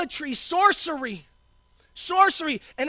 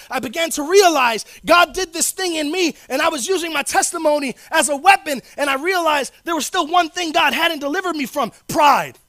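louder and louder, landing hard on pride; building preaching; 3 semitones higher, 7 dB louder than usual. Real, not acted. Pastor to congregation, building loud to pride. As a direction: build up and slam the word pride.

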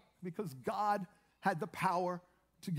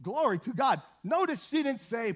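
ordinary talk

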